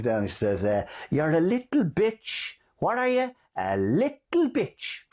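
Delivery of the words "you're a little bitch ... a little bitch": put-on high, slow sing-song baby talk to a pram; cooing to a baby with a sweet high lilt; coochie coo baby talk. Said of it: "bends down in real coochie coo fashion".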